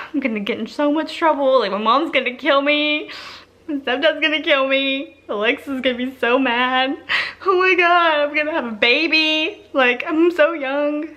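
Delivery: high, escalating panicky whine; acted panic. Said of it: "my emotions that were going through my head".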